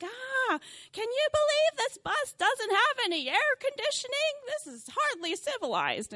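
high, loud, complaining tourist lady voice; mock the entitled tourist ladies with a high whine; whiny American tourists. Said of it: "they are super loud, and they complain about everything".

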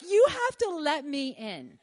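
slow, pleading, in a changed, strained voice; a half-laughing mock panic at the door; desperate plea. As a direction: beg to be let in before the dog bites. Begging the old lady at the door.

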